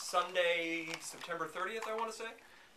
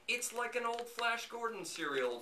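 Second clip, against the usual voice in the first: higher-pitched mock explanation; George Lucas; 9 semitones higher than usual. Filmmaker defending his title to puzzled fans, mock-explaining. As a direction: put on a high voice to justify a silly title. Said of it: "Lucas came out and said".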